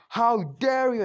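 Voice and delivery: put-on angry girl. Sakura yelling at Naruto before she punches. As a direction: hurl it at him, high and loud. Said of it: "Sakura goes".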